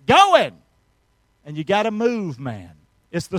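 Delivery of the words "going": loud burst. Acted. Preacher urging to get going.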